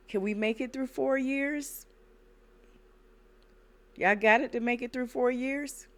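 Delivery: exasperated warning to viewers; worked up. Real, not acted. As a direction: raise the voice in disbelief. Worked-up warning about repeat history.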